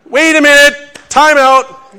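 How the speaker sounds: loud, high burst of alarm; startled shout. Acted. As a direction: shout it like calling a sudden halt. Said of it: "could use even stronger language".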